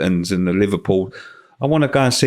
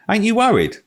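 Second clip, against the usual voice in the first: mock-panicked question, pitched well up; imitating panic; 13 semitones higher than usual. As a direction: play the panicking Tottenham player, hammed-up. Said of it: "he's fucking panicking".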